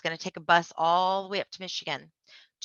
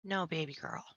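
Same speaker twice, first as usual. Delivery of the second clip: tender whisper; 10 dB quieter than usual. Retold or imitated. Answering little sister tenderly.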